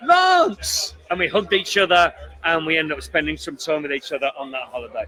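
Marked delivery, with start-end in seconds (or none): 0.1-0.9 excited greeting. Retold or imitated. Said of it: "And I just went".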